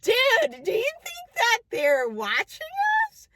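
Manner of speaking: high, urgent suspicious question to a friend; nervously asking if they're being watched; alarmed whisper-ish aside. Retold or imitated. To her friend at the bar, high alarmed question. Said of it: "And I was like".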